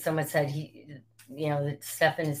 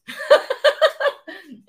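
high laugh; 7 dB louder than usual. Real, not acted. Laughing at second call.

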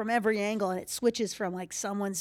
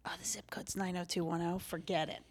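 whisper; 3 semitones lower, 10 dB quieter than usual. Retold or imitated. Whispered aside about fancy zip code.

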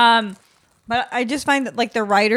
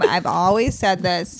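normal talking voice

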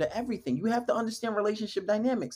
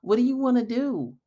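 higher-pitched, put-on needy questions; mock clueless asking; 4 semitones higher than usual. Man asking her instead of leading, mocked in a raised voice. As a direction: mimic a man who waits to be led. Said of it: "Don't be asking her".

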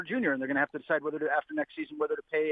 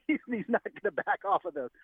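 laughing; 8 semitones higher than usual. Real, not acted. Joking about Porter taking shots.

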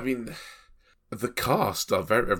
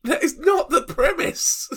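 indignant; 5 dB louder than usual. Real, not acted. Outraged about the film's premise.

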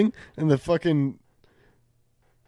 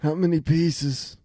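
raspy mob boss. Acted. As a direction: growl it like an aging don. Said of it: "not a mobster accent. It's just raspy".

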